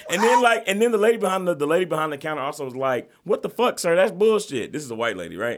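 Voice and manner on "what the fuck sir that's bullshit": a higher, louder voice for the clerk telling him off; the store clerk calling out a rude customer; cashier scolding. Retold or imitated.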